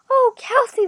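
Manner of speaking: high, loud acted sob; sobbing outburst. A narrator's reading. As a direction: wail the name in anguish. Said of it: "the woman sobbed".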